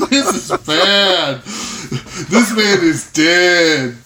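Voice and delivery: ordinary delivery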